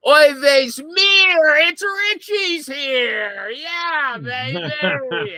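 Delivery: put-on high, slow hammy Yiddish accent. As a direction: ham up an old Yiddish uncle, slowly. Hammed-up Yiddish-style entrance.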